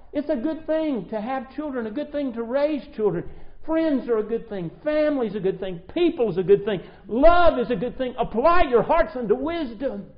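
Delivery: loud, fervent pulpit delivery; impassioned preaching. Acted. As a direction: hammer each good thing home. Preacher at full force.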